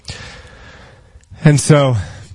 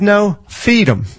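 pitched-up calm command to feed the enemy; gentle prophet; 9 semitones higher, slower than usual. Acted. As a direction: say it slowly and high, almost amused. Elisha's reply voiced in story.

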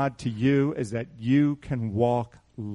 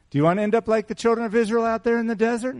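fast, much higher challenging question; pointed question; 8 semitones higher, faster than usual. Shown as a demonstration. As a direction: push it at them as a challenge. Same warning asked as pointed question.

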